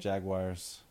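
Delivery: JAGUARS said as "JAG-wires"; odd pronunciation. Real, not acted. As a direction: end Jaguars like the word "wires". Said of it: "Would you just say Ja".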